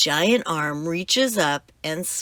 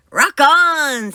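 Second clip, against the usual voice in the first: excited cheer; 8 semitones higher, 7 dB louder than usual. Acted. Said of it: "says Captain Pete".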